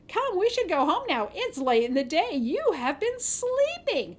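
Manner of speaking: put-on girl waking her sister, hiding the secret; sweet innocent. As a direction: lightly feign innocence. Two Eyes wakes sister.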